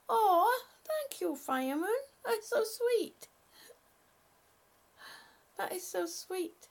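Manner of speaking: moved squeal of thanks to a kind viewer; touched thanks. Real, not acted. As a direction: gush with heartfelt gratitude.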